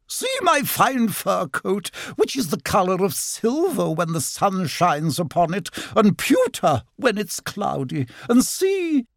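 high, self-admiring showing-off; haughty otter. A narrator's reading. Otter showing off her fur coat, haughty. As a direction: parade each feature in a high, grand voice.

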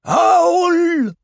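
high, loud drawn-out wail; ghostly. A narrator's reading. Mysterious voice haunting Owl from the chimney, ghostly. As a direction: call like a phantom from a chimney. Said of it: "a ghostly voice, unlike any voice".

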